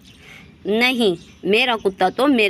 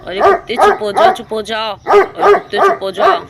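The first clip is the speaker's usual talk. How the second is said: loud, urgent shushing yell; telling the dog off; 6 dB louder than usual. Acted. Thief to the barking dog, loud and desperate. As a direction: shut that dog up now.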